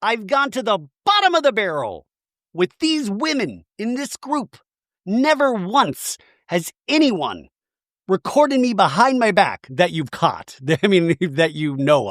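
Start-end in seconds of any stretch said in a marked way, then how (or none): 0.0-10.6 mock lecturing Shannon. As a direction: lecture her, pointing. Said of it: "Shannon, back to her, she's in full".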